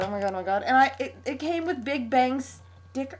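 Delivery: usual speaking voice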